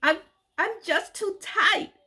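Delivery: very high, slow, faltering plea; timid explanation. Retold or imitated. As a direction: stammer it out, scared and small. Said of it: "I tried to explain to her".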